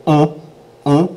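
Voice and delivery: short grunted "Uh", as if punched in the gut; "Uh" as a brief rising French un. Shown as a demonstration. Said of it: "like you've been punched in the gut".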